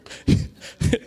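breathy burst of real laughter; a quick unvoiced chuckle. Real, not acted. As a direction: laugh at the story. Laughs at his own story.

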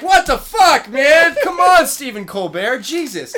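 loud, high exasperated outburst; losing it. Retold or imitated. Fan reliving his fury at a movie, exasperated shouting. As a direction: yell it like you've just lost it. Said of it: "I just fucking lost it".

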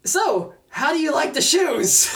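high, slowed, drawn-out mock innocence; pointed casual question. Shoe owner springing the dreaded question, pointedly casual. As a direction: pitch it up and linger on the question.

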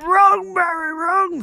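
squeaky, high-pitched "Wrong, Barry, wrong"; mock him in a silly falsetto; high mocking correction. Harrison correcting Barry, in a squeaky mocking falsetto. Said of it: "And he's like".

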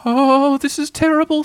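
very high, loud dismayed wail; high-pitched panicky dismay; distressed Piglet. A narrator's reading. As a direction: wail it in a tiny creature's high voice. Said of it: "thought Piglet".

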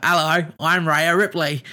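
mock the broad Australian wrestler accent. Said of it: "she has got the, uh, she's got, like, that".

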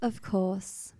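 soft, forced niceness; like a disappointed teacher who has to be nice; quietly grudging courtesy; disappointed but polite. Acted. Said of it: "she says it in that way".

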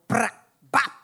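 a loud, sudden mock bang from the bus; loud imitated clunk; imitated crash noise. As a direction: blurt it out like something just broke. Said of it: "we just hear this thing go".

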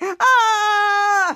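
long, high mock scream of pain; hammed-up sprayed-in-the-face yell; dramatic scream. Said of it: "[name] being so dramatic".

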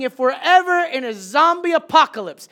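high, loud mock despair with a laugh; loud joking lament about the team; loud complaint. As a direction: shout the joke to the team. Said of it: "and I'm saying".

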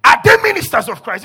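loud preacher reading out scripture; loud, challenging pulpit reading; forceful scripture reading. Acted. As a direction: proclaim the verse at full force. Reads scripture at full force.

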